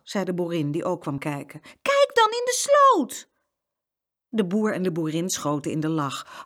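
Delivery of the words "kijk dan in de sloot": high, loud, drawn-out urging; urgent exclamation. A narrator's reading. Boy urging grown-ups to look in ditch, urgent. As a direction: urge them loudly, high and impatient.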